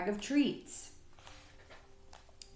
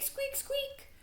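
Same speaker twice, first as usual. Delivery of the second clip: tiny squeaks; 10 dB quieter than usual. Acted. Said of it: "probably sounded like squeak".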